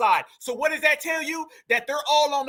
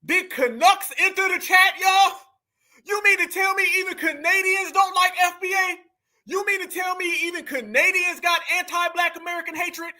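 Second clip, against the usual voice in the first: incredulous; 6 semitones higher than usual. Real, not acted. Reacting to Canadians joining the chat, incredulous. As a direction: call it out like astonishing breaking news. Reacting to clip with mock disbelief.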